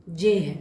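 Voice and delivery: letter G in French, zhay. Shown as a demonstration. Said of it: "जी का pronunciation". (जे को French "g" की तरह, झे)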